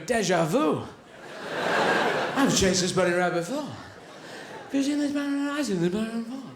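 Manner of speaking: a hint of baffled wonder, rising in pitch; puzzled greyhound. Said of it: "Now they should be going".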